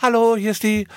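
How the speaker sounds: high, chirpy influencer hello; lightly played influencer greeting. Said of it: "es ist so Takeovers".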